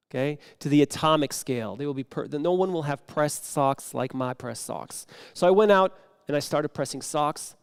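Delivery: usual speaking voice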